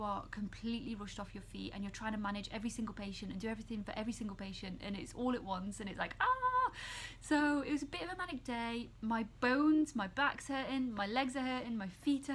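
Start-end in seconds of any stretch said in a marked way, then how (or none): 5.8-6.7 a high, loud frazzled ah; exasperated cry. Retold or imitated. Nurse recalling a manic shift, high frazzled ah. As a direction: let out a burst of stressed-out frustration.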